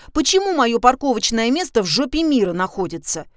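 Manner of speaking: petulant, rising complaint; capricious. A narrator's reading. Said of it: "капризным голосом".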